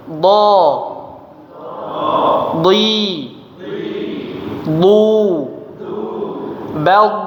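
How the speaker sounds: "dhi" and "du" said thick and flowing, lips not smiling; heavy dhad drill. Shown as a demonstration. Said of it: "Coba kita coba". (dhad tebal, suara mengalir)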